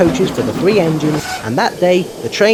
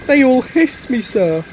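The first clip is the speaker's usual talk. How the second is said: sad; 10 semitones higher, 5 dB louder than usual. Acted. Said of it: "answered Edward sadly".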